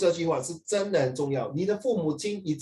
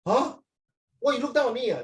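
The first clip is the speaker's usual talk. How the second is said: higher pitched, indignant student voice; huffy student, pitched up high; offended student; 7 semitones higher than usual. Retold or imitated. Offended student in classroom skit.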